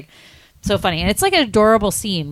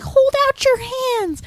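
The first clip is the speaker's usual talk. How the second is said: very high, drawn-out granny command; hammed-up high-pitched old lady; excited old lady; slower than usual. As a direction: squeal it as an eager granny. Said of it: "she's, like, so excited".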